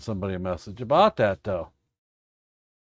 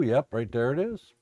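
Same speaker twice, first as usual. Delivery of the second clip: usual speaking voice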